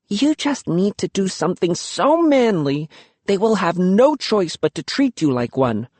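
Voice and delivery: quick and high, rushing the idea; eager idea. Acted. Luki pitching her bold plan, quick and eager. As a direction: sell him the plan.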